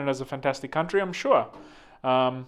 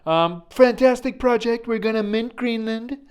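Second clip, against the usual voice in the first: high, loud put-on presidential hype voice; hammed-up president announcing a project; mock Trump pitch; 8 semitones higher, 5 dB louder than usual. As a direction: sell it like a grand salesman. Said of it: "He's like".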